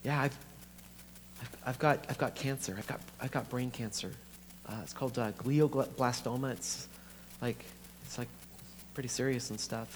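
soft, halting confession; breaking bad news. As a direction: break it to her quietly, stumbling over the words. Breaking cancer news to sister.

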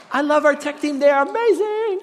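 high, loud sing-out of praise; playfully over-the-top high cheer; gushing praise. Real, not acted. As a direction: gush about the crew way up high. Playful praise after slide fix.

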